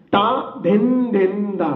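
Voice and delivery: slow, even syllables ता धिन धिन धा; slow recitation. Shown as a demonstration. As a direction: spell out ता धिन धिन धा slowly, one bol at a time. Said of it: "अभी आखिर की चार मात्रा है".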